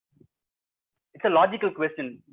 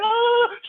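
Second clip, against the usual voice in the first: high loud wailing oh; high loud oh cry; 7 dB louder than usual. Acted. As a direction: wail out in distress. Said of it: "Chippu says".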